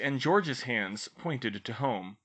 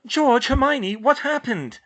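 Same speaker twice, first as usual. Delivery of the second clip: alarmed mother; 14 semitones higher, 10 dB louder than usual. A narrator's reading. Alarmed mother demands answers.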